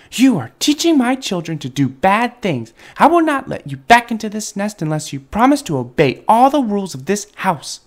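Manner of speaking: high, indignant telling-off; stern high-voiced mama bird; scolding mother bird. A narrator's reading.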